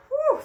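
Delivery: high, loud relieved whew. Acted. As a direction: blow out in mock relief. Relief after noisy scene.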